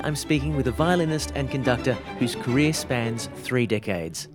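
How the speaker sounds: normal talking voice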